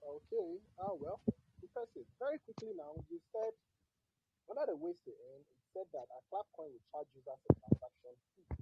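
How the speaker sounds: faint and distant; barely audible. Real, not acted. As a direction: voice dropping out, very faint. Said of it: "your voice is very low".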